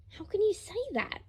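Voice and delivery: put-on high young voice, wounded; a small boy asking, stung; hurt child's voice. Grandson asking Lucius, high hurt child voice.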